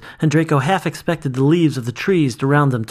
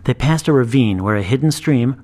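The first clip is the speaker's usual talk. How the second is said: plain reading voice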